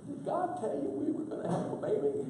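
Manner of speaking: high, quick skeptical wife. Retold or imitated. Sarah questions Abraham, quoted.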